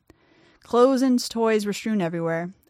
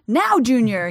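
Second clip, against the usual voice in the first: urgent shout, much louder; 3 semitones higher, 8 dB louder, slower than usual. A narrator's reading. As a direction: shout the order in a panic. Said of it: "he shouted".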